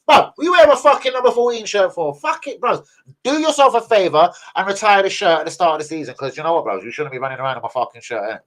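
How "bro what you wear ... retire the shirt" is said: fuming outburst. Real, not acted. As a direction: blast him for taking the shirt. Fuming at player's shirt number.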